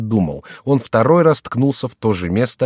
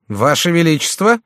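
higher, louder astonished query; startled grumble; 9 semitones higher, 5 dB louder than usual. A narrator's reading. Hidden Chicot grumbles at overhearing a royal title, startled. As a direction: echo it in disbelief, pitch climbing. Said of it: "проворчал Шико".

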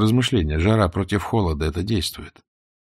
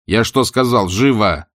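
raised, loud barked order; feigned rage; 4 semitones higher, 6 dB louder than usual. A narrator's reading. Spark orders his captive to move, feigning extreme rage. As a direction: snap it out in put-on fury. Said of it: "изображаешь крайнюю степень ярости".

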